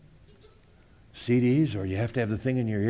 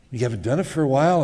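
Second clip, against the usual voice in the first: normal talking voice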